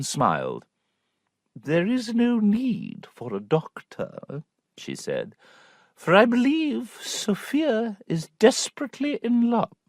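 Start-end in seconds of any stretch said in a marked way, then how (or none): 1.5-9.8 knowing aunt. A narrator's reading. Aunt voice, slow.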